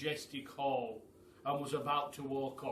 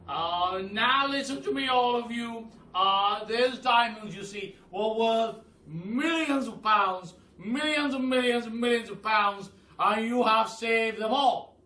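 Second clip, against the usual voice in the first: higher, loud, booming praise; old Duke praising the animals, loud and higher; 8 semitones higher, 13 dB louder than usual. A narrator's reading. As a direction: hail them like a grand old duke. Duke voiced in the story.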